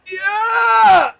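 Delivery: huge high victory yell; excited shout. Real, not acted. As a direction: cheer at the top of your lungs. Celebrates a game win.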